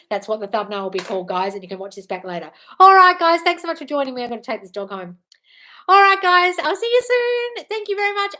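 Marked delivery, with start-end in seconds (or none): 2.8-4.2 high, loud wrap-up call; big, raised-voice farewell opener; calling out sign-off. Real, not acted. Calling out to end the livestream. 5.9-8.3 high, loud sing-out goodbye; high-pitched, stretched-out "see you soon"; cheery sign-off. Real, not acted. Calling goodbye to livestream viewers.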